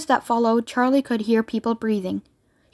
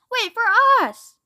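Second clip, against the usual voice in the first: distant, softened shout; far-off plea to wait; 9 semitones higher than usual. A narrator's reading. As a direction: call out high but held back, like a fading echo.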